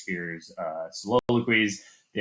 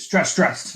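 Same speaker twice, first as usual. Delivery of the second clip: two intense beats on "stressed, stressed", second one higher and louder; spondaic double stress; 8 semitones higher, 7 dB louder than usual. Shown as a demonstration. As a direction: punch both words, "stressed, stressed", equally hard. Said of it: "It's just both really intense".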